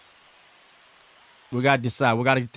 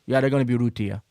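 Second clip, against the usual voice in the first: ordinary delivery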